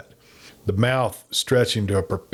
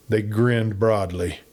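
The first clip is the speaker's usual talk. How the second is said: ordinary delivery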